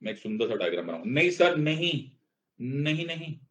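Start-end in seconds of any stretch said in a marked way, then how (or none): 1.0-3.4 protesting student. Acted. Teacher voices protesting students.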